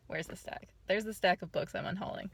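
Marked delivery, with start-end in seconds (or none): 0.1-0.7 muttered. Real, not acted. Looking for books in car.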